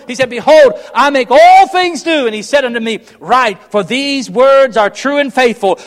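loud, high, driving sermon cadence; full-force preaching. Acted. Preacher urging his Baptist congregation, at full force.

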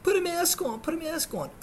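high mocking nurse voice; mimicked nurse. Patient mimicking nurse's mask order, high mocking voice. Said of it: "she's telling me".

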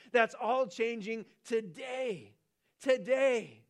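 rising to a loud, slow cry of today; excited proclamation. Acted. Angel telling men, rising to an excited cry. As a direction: announce it like the news can't wait. Angel announcing the change, preacher.

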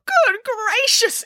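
very high, slow, drawn-out dismay; shocked exclamation. A narrator's reading. Boris appalled by the piglets' fate, shocked exclamation. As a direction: exclaim it aghast, stretching the words. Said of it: "exclaimed Boris".

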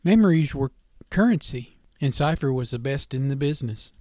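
ordinary delivery, nothing marked

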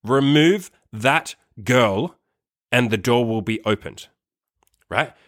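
slow, deliberate words with pauses between; calm, direct, serious. Retold or imitated. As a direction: lay down a measured ultimatum, word by word. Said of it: "very calm but very direct and serious voice".

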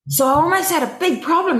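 lightly put-on American accent, high. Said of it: "in his American accent".

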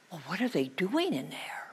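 put-on boy whispering to the witch about the trolls. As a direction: whisper it so the trolls don't hear.

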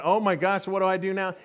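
usual speaking voice

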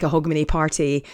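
usual speaking voice

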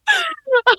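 high-pitched burst of laughter; high squealing laugh. Real, not acted. Laughing at reading mistake.

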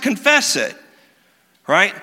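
ordinary delivery

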